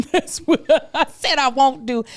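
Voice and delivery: amused self-mocking laugh. Real, not acted. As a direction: laugh at your own words. Laughs at own vow.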